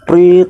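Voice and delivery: sharp high whistle blast, loud; loud mock whistle prit; whistle imitation. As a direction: blow the whistle with your voice. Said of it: "gua bilang gitu".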